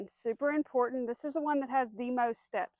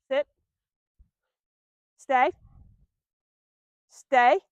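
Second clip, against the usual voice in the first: louder firm stay; 2 semitones higher, 10 dB louder, much slower than usual. Shown as a demonstration. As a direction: say it like a stop sign. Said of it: "Firm tone".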